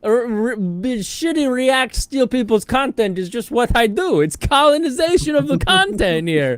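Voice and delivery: high, loud mocking voice; squeaky put-on mockery of him; ex impression. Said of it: "this is my ex voice".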